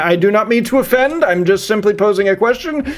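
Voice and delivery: ordinary talk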